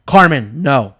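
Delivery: loud, firm imitation of a scolding wife; retold anger. Wife scolds husband by his name, retold anger. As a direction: lay down the law as the mad wife. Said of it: "She got so mad just because I called them dumb".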